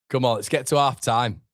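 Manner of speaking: ordinary delivery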